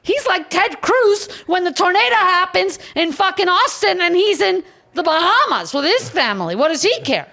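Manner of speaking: high, loud indignant tirade; worked-up outrage about the guild head; heated rant. Real, not acted. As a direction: rail against the absent boss.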